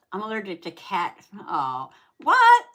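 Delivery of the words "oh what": "Oh, what?" shrieked much louder and higher in real shock; surprised scream. Real, not acted. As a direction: let out a startled shriek of disbelief. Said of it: "I don't mean to scream".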